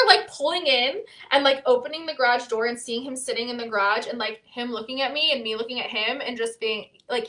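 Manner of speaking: ordinary talk, nothing marked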